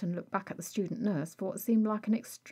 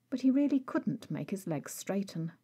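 plain reading voice

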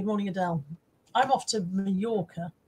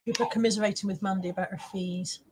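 ordinary talk, nothing marked

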